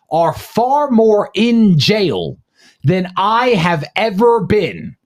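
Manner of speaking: slow, every word weighted, far and ever leaned on; slow emphatic. Real, not acted. Host proclaiming to listeners, slow and emphatic. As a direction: drag it out for emphasis. Defiant speech about jail.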